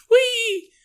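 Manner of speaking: very high mock squeal of glee; a cartoonish whee on takeoff; playful whee. Jesus escaping security after a miracle, high playful whee. Imagined Jesus flees, floating off.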